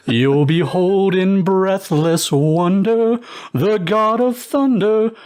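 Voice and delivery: ordinary delivery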